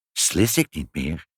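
ordinary delivery

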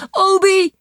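high, loud scream of a name across the water; shrieking call. Acted. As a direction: shriek his name from the bank in fear. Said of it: "gilt Saar vanaf de kant".

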